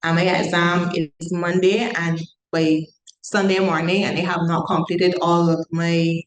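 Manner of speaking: ordinary delivery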